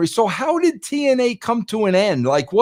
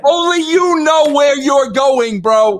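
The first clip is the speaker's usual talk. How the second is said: exasperated shout; 6 semitones higher, 3 dB louder, slower than usual. Real, not acted. Host keeps protesting the story.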